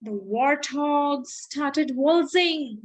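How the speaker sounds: ordinary delivery, nothing marked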